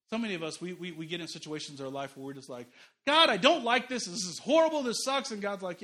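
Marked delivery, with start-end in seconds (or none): 3.1-5.3 whiny complaint. Retold or imitated. Believer complains to God about hard times, loud whining.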